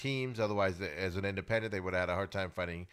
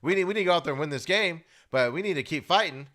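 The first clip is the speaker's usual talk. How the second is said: model coach's rallying talk; 6 semitones higher, 5 dB louder than usual. Retold or imitated. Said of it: "That's what you need to say".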